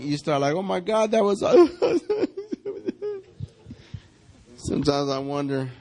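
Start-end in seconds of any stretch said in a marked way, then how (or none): 0.5-1.4 high voice tipping into laughter; hammed-up switch from crying to laughing; mock giddy laughing. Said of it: "then you start laughing". 1.5-3.5 high, loud hammed-up laugh; imitated out-of-control laughter; mock hysterical laugh. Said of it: "then you start laughing".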